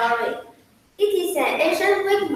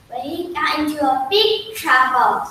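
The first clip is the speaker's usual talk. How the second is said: ordinary delivery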